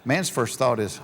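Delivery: normal talking voice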